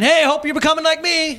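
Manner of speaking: hammed-up aloof God calling down; distant God. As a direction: holler down from a mountaintop, mockingly. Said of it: "That's God saying".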